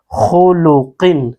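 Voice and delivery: slow demo of خلقن with a thick, heavy خ, the mouth full; heavy full-mouth letter. Shown as a demonstration. Quran teacher showing students the heavy خ, slow and full-mouthed. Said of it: "کیسے پڑھیں گے؟".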